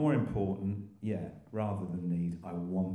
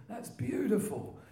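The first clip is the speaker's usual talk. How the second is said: put-on high, drawn-out old-timer's admiration; Len B impression; 12 semitones higher, slower than usual. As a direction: savour it slowly as an impersonated old Croydon character. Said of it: "I could actually impersonate him to a T".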